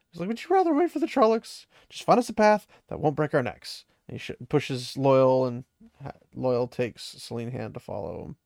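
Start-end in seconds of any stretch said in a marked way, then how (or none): 0.3-3.8 high-pitched impatient comeback, quick at first; retold exasperated retort. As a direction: imitate someone fed up and urging action.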